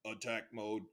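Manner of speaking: deep, soft robotic voice. Retold or imitated. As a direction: drop low like a machine booting up. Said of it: "Joyce was like".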